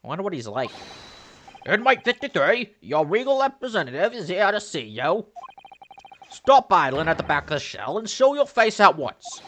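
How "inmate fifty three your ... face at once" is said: loud, high official summons; stern jailer. Acted. Jailer summoning an inmate to show himself, stern and loud. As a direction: bark orders into the cell.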